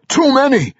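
very high urgent yell; alarmed cry. A narrator's reading. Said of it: "Magnus cried".

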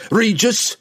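high, loud one-word shout; defiant shouted answer; shouted retort. A narrator's reading. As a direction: shout the name straight back. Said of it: "Wulfgar shouted back".

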